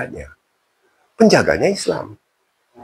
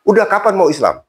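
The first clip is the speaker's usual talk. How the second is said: impatient demand; 10 semitones higher than usual. Retold or imitated. Habib pressing the convert.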